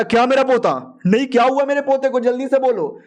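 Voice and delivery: high, alarmed disbelief at the news; a grandfather jolted by bad news; shocked elder. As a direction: demand the news in a rising panic. Elder hears his grandson died.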